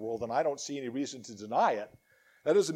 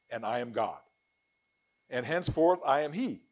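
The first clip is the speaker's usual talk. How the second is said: solemn, declaiming Bible reading; scripture reading; 5 dB louder than usual. Acted. Preacher reading Isaiah to his congregation, solemn declaiming. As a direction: proclaim the words of the Lord to the congregation. Reads Isaiah aloud in church.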